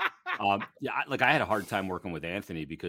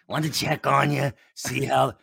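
mock voicemail voice; 6 semitones higher, 6 dB louder, slower than usual. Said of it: "I get a camera voicemail".

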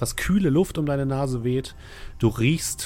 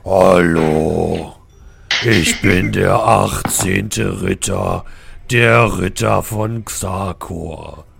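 deep, booming giant stone knight; thundering knight voice; 3 semitones lower, 4 dB louder, slower than usual. Acted. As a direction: speak down from a great height, slow and booming. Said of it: "mit donnernder Stimme".

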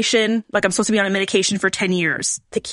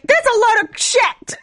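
much higher, drawn-out exclamation; dismayed disbelief; 12 semitones higher, slower than usual. Retold or imitated. Patient reacting to poor fertility odds, high dismayed disbelief. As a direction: squeal it slowly in disbelief.

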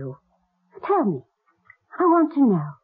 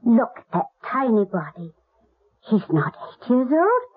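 ordinary delivery

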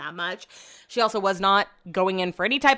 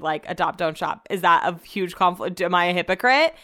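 ordinary talk, nothing marked

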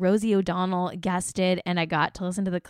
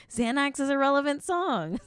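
high-pitched joking claim; 7 semitones higher than usual. Acted. Host joking about anxiety medication, high-pitched. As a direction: voice lifting high. Jokingly repeats her point, higher.

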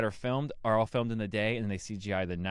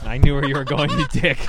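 laughing outburst; 3 semitones higher, 11 dB louder than usual. Real, not acted. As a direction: shout it through laughter. Catches friend's joke.

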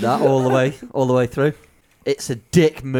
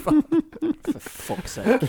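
laughing exasperation; 12 semitones higher, much slower than usual. Real, not acted. Groaning at a silly question.

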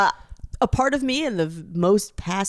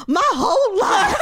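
laughing, higher, slow overblown sigh; mock melodrama; 7 semitones higher, slower than usual. Friend mocking a stranger's compliment with a laughing dramatic sigh. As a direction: ham up a tragic confession. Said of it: "a dramatic turn and a look".

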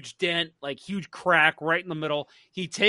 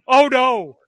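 higher and louder panicked cry; freaking out; 7 semitones higher, 7 dB louder than usual. Retold or imitated. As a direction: blurt it out as if you just killed a man. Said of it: "freaking out".

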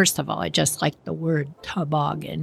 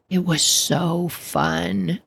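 ordinary delivery, nothing marked

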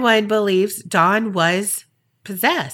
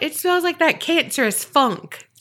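mocking sniff; 9 semitones higher, 4 dB louder than usual. Mocking quoted reverend's claim.

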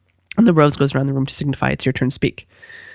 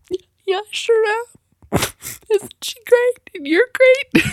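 teary high squeak; slower than usual. Retold or imitated. Mom answering a kid in class, teary high squeak. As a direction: voice jumps way up, choked with happy tears. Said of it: "And I'm like".

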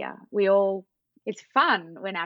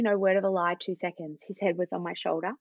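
ordinary delivery

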